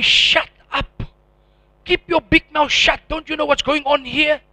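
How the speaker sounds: loud, high, harsh order to shut up; angry inner voice. Retold or imitated. His own mind telling him to shut his mouth, angry and loud.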